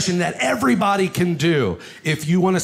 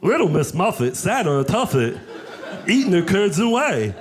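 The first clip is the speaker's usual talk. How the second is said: lightly voiced Wreck-It Ralph; John C. Reilly voice. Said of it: "John C. Reilly from Wreck-It Ralph".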